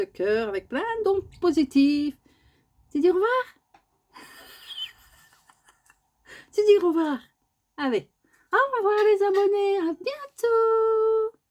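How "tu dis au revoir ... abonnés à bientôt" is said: very high squeaky goodbye for the bird; voicing the bird.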